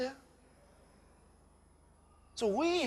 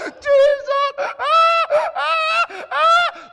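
high, loud acted wailing of Jesus and ah; hammed-up grief wail, much higher and louder; hysterical wailing; 8 dB louder, much slower than usual. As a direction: wail out loud as if someone just died. Acted mourner wails at death.

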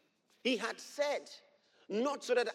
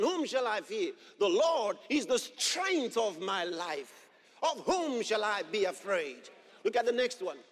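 loud, rising sermon delivery with shouted peaks; fiery preaching; 3 semitones higher, 4 dB louder than usual. Acted. Pastor proclaims scripture to the congregation, preaching at full force.

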